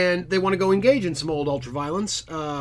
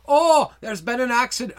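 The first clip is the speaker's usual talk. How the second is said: light Irish lilt, pitched up; Irish accent; 7 semitones higher, 4 dB louder than usual. Retold or imitated. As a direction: play Alex with a sudden Irish accent. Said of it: "I don't know why I'm making him Irish".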